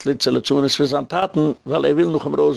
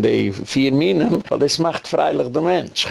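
ordinary talk

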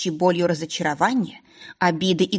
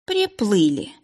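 groaning; 3 semitones higher, slower than usual. Acted. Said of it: "мысленно простонала я".

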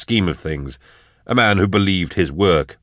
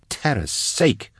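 high, drawn-out sneer; exasperated, contemptuous sneer; 7 semitones higher, 3 dB quieter, slower than usual. A narrator's reading. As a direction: sneer it slowly, pitched up. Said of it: "Drusha sneered".

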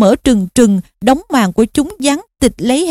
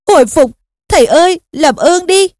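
higher-pitched, urgent begging; desperate pleading; 5 semitones higher than usual. A narrator's reading. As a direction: beg him to wake up. Harry tries reviving his teacher. (van nài khẩn thiết)

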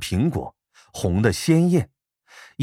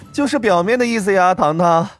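high, laughing playful teasing; giggly teasing; 7 semitones higher than usual. Acted. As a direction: tease with a giggle. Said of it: "嘻嘻一笑".

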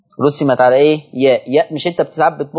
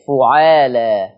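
drawn-out word; 3 semitones lower, much slower than usual. Shown as a demonstration. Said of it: "بضم الفاء".

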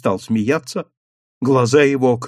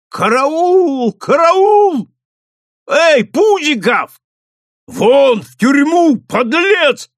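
громкий крик, намного выше; звать караул во всё горло; крик о помощи; 13 semitones higher, 6 dB louder, slower than usual. A narrator's reading. Совхоз зовёт на помощь. (совхоз кричит караул)

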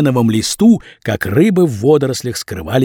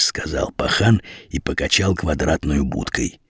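usual speaking voice

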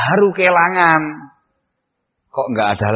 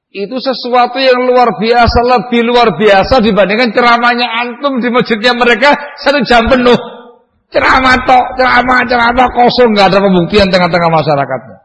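full-force preaching; 10 semitones higher, 6 dB louder than usual. Acted. Preacher contrasting real help with empty lectures, at full force. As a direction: hammer each phrase from the pulpit.

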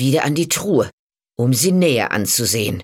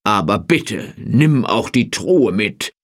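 eager bargaining; 3 semitones lower than usual. A narrator's reading. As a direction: urge the deal, a bit pushy. Farmer bargaining, lower voice.

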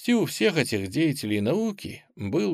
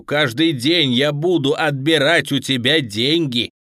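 spiteful threat; 4 dB louder than usual. A narrator's reading. Captor promising the bound boy hell, spiteful threat building. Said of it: "злобно произнёс Петров".

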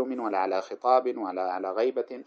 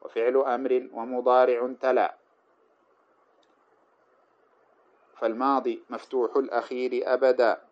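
verse recitation. Acted. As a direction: recite the verse in a measured chant. Reciting the grammar poem.

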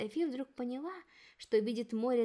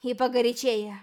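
grim puffing order; 8 dB louder than usual. A narrator's reading. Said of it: "мрачно пропыхтела Софи".

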